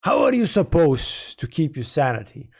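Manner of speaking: usual speaking voice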